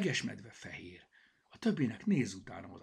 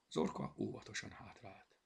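ordinary delivery, nothing marked

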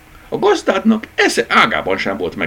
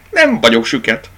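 louder, offhand retort; tossed-off retort; 6 semitones higher, 6 dB louder than usual. A narrator's reading. Rabbit tosses it at the dachshund, offhand. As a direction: throw it back carelessly. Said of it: "vetette oda".

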